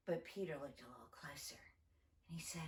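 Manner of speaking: whispered, quick storytelling; hushed narration. A narrator's reading. Telling the children a secret moment, whispered. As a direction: lean in and whisper it.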